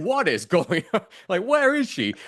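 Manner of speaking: high, drawn-out baffled exclamation with a laugh; mock bewilderment. Podcaster reacting to the accent slips, laughing mock bewilderment. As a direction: cry out in amused, high-pitched confusion.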